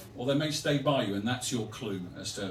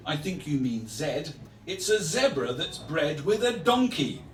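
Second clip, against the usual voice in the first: lively showy verse, higher and swinging in pitch; comic poem performance; 4 semitones higher than usual. Acted. Poet touting a zoo's odd animal to visitors, showy recital. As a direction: roll up the crowd with bouncing rhymes.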